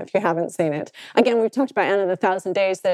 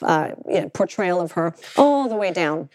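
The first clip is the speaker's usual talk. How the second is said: usual speaking voice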